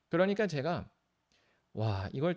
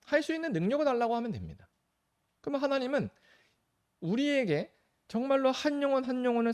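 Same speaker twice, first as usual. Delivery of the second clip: much higher, louder preacher's cry; preaching at full force; 12 semitones higher, 5 dB louder than usual. Acted. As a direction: rise into a fervent sermon peak. Preaching climax. (열정적으로 높이 외치는 설교)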